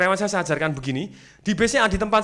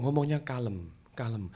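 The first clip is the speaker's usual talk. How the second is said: low, soft, unhurried words; calm; 5 semitones lower, 9 dB quieter, slower than usual. Retold or imitated. Describing parents' friend who talks calmly, low and soft. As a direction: drop down into a mellow murmur.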